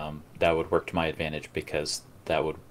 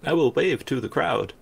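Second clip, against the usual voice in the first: joining in the interviewee impression, much higher; mock driver's interview voice, pitched up; Fraga; 9 semitones higher, 4 dB louder than usual. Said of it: "they asked Fraga what he thought".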